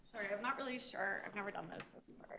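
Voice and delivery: fast, soft nervous apology; rushed nervous introduction. Real, not acted. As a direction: hurry it out, unsure of yourself. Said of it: "Sorry, I'm a little nervous".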